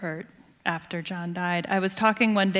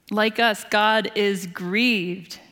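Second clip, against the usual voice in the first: usual speaking voice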